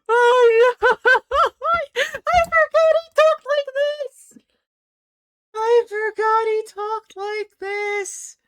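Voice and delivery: high, loud squealing laugh through the words; real hysterics, squealed out slowly at full volume; helpless laughter. Real, not acted. Player reacting to how he talks, squealing with laughter.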